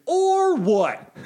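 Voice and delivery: high, drawn-out mock challenge; childish defiance. Told by his partner to stop, childishly defiant. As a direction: stretch OR way up high, then drop.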